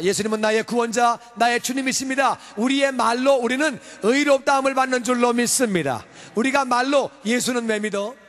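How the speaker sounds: fiery sermon delivery; full-force preaching. Acted. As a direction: proclaim it from the pulpit.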